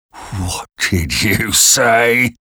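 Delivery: slow, drawn-out threatening question; menacing convict. A narrator's reading. Narrator voices the menacing convict.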